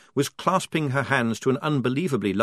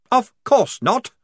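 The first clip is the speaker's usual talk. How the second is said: high, slow, loud snap; indignant officer snapping back; snapped; 12 semitones higher, 7 dB louder, slower than usual. A narrator's reading. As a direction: bite the words off sharply. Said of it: "snapped Blouse".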